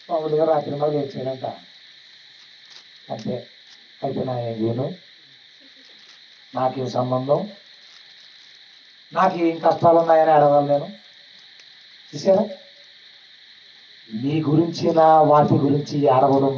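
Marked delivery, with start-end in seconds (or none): 0.1-10.9 indifferent believer. Retold or imitated. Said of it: "మనకేమనిపిస్తుంది అంటే".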